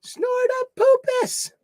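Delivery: high, slow sing-song name call; gleeful high-pitched shout-out to a viewer; excited call. Real, not acted. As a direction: stretch the name out like a delighted cheer.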